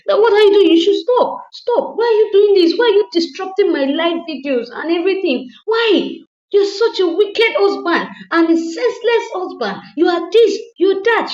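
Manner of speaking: high, loud shrieking rant; furious screaming wife. Retold or imitated. Wife screaming at husband for disrupting her live, shrill fury.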